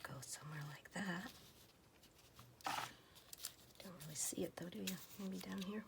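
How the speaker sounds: hushed, breathy ASMR murmur; soft whisper. Acted. Crafter whispering to viewers while sorting stamps, soft whisper. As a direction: whisper it close to the mic.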